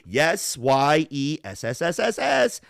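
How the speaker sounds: long hissing s like steam; drawn-out s like a snake. Shown as a demonstration. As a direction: let the s hiss out slowly. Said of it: "Steam escaping".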